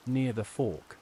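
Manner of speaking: ordinary delivery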